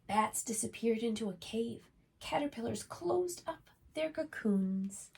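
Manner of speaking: gentle storybook reading voice; reading to children. A narrator's reading. Reads a picture book aloud.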